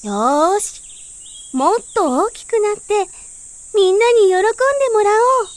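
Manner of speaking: rally yourself to grow big; determined bud. A narrator's reading.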